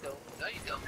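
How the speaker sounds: breathy whisper, no voice. Acted. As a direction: whisper it mockingly. Repeated line, second whispered.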